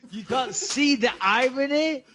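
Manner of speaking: quoting a film character with mock scorn; movie quote. Co-host mocks the listener with a film quote, slow and high. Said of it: "What movie is that?".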